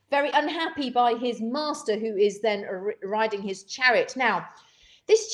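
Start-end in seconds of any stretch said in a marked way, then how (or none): none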